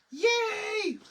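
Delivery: high, loud happy yay; excited little cheer; delighted cheer. Real, not acted. Finds more pieces.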